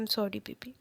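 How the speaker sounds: quietly apologetic, lightly voiced; husband apologizing softly to his injured partner; emotionally. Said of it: "एकदम emotionally कहता है".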